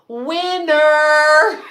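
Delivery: loud, high mock game-show cheer; sarcastic cheer. Mocking a rude stranger as a prize winner, loud sarcastic cheer. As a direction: shout it like a prize announcement, all sarcasm.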